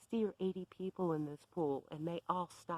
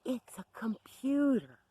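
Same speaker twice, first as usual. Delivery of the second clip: loud, higher, slow mock yell; imitated scream; 6 semitones higher, 7 dB louder, slower than usual. Bev yelling in the narrator's face over the Mac, loud mock scream. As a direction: yell it right in their face. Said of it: "she screams in my face".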